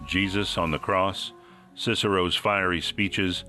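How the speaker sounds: ordinary talk, nothing marked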